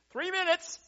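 high, loud studio call; acted countdown shout. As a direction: yell it across a busy studio. Crew calling countdown in story.

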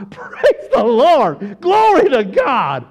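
loud, drawn-out exultant cry; shouted praise. Acted. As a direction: shout praise in defiance of bad news. Joyful praise at bad news.

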